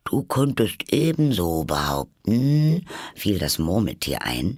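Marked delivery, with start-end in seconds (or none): 0.0-2.9 slow, drawn-out sleepy Dormouse; talking in sleep. A narrator's reading. Dormouse joins in from its sleep, drowsy. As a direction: drag the words out as if half asleep. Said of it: "das im Schlafe zu sprechen schien".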